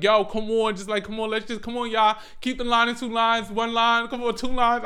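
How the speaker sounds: acted, hurried plea to line up; harried urging. Herding kids into lines.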